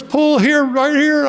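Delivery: high, loud insistence; frantic Paul. Retold or imitated. Paul ordering the driver off the road, high frantic yelp. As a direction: yelp the directions.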